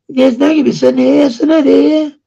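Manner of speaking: high, loud, quick Fyn dialect; sing-song Fyn dialect, pitched well up. Shown as a demonstration. Showing listeners the dialect where they live, Fyn dialect. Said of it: "There's a Fyn dialect where I live".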